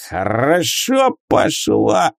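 tipsy, slow and slurred; a drunk wizard savoring a drink. Acted. Drunk wizard after potion.

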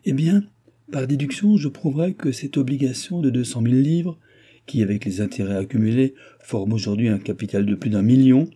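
put-on the anxious old countess insisting on her rights; old pleader pleading.

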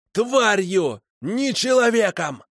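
очень высоко, «человеком» медленно; кричит, растягивая последнее слово; яростный крик. Acted. Макар продолжает кричать. (Макар кричит, очень высоко и медленно)

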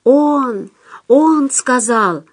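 high, drawn-out denunciation; eager informers calling out; several accusing voices. A narrator's reading. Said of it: "И потом несколько голосов".

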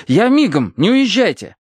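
high, urgent calling; crying out. A narrator's reading. Narrator begs the party to wait, crying out. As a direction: call back high and urgently. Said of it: "вскричал я".

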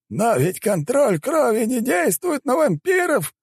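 very high, slow, strained cry; incredulous high-pitched shout; shaman's exclamation. A narrator's reading. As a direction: exclaim in shocked protest, stretching the words. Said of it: "воскликнул шаман".

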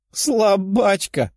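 high, drawn-out taunt; giggly teasing. A narrator's reading. Princess mocks her exhausted rival, giggly teasing. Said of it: "хихикнула принцесса".

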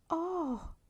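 high delighted gasp of an oh; thrilled at first sight; joyful oh. A narrator's reading. Barbara spots the feather bed, joyful cry. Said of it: "esclamò gioconda".

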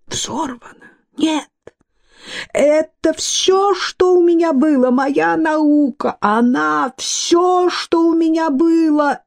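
high, thin, drawn-out lament; squeaky, distraught scientist. A narrator's reading. Scientist learns his lab is blown up, squeaky despair. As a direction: wail it in a tiny, high-pitched voice.

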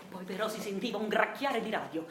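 usual speaking voice

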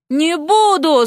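high-pitched, mildly yelled protest; yelled refusal. A narrator's reading. Vasya refuses to be his wife, yelling. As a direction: refuse point-blank in alarm. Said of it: "заорала Вася".

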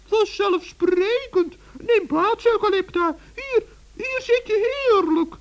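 very high, chirpy little gnome; a tiny forest gnome, all innocence; high gnome voice teasing the witch. Acted.

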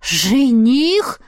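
incredulous echo with a long rising tune; surprised question. A narrator's reading. Heroine hears the man is her fiancé, surprised. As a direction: swoop up in astonishment. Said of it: "Я приподняла бровь".

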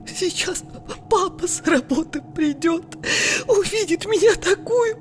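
high tearful sobbing voice; crying mother. Acted. Mother weeping to herself over her looks, tearful high voice. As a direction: sob it out, voice cracking high.

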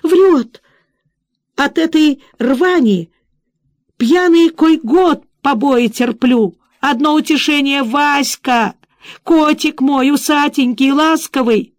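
high, slow, whining complaint. Acted. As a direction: whine out the grievance. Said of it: "заныла скорчившаяся бабёнка".

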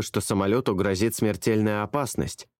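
usual speaking voice

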